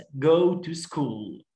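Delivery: slow, careful classroom demo; slow model reading. Shown as a demonstration. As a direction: go to school drawn out very slowly for the class. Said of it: "go to school يعني يذهب إلى المدرسة".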